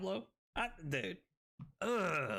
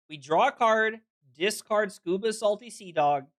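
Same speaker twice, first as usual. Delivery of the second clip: louder, steady caster voice; play-by-play commentary; 12 dB louder than usual. Acted. Caster calling a card game round for viewers, steady play-by-play. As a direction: call the match for the viewers.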